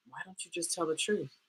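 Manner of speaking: soft, calm friend's suggestion. Retold or imitated. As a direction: say it quietly and simply, imitating a calm friend. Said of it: "she just very calmly was like".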